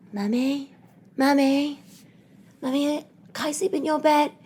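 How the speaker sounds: put-on high, soft small-child voice; gentle little child. Young daughters at mother's bedroom door, gentle pleading. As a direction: plead sweetly like a sleepy little girl. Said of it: "knock on my door ever so gentle".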